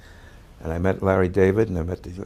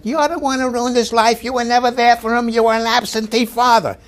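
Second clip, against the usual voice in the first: much higher, louder accusing yell; mock screaming wife; 7 dB louder than usual. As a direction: scream the blame at him like the TV wife. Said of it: "she said".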